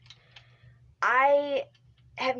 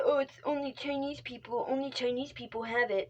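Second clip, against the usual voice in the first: mocking quote; 4 semitones higher, 4 dB quieter than usual. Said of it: "I'm gonna say".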